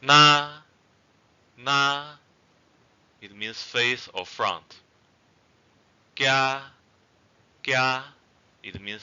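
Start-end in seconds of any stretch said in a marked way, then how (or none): 0.0-2.0 "Na" high, loud and level. Shown as a demonstration. Teacher gives example words for tones, high level tone. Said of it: "or high level tone". 6.1-8.0 "Kya" held high and level. Shown as a demonstration. Teacher models the word for seedling, high level tone. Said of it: "or high level tone".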